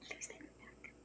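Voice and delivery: barely audible, breath-only plea; whispered begging. Acted. Imagined ex on bended knee to viewer, whispered begging. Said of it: "begging you".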